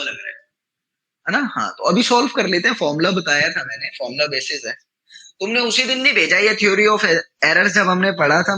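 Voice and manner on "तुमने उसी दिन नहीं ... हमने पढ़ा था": loud complaint. Real, not acted. Teacher complains loudly.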